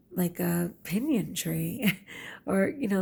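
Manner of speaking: ordinary talk, nothing marked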